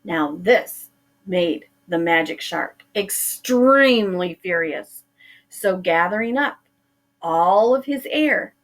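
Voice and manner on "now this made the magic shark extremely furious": plain reading voice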